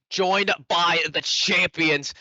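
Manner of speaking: loud, high announcer voice; big hype intro to the crowd. Real, not acted. Host opening a broadcast.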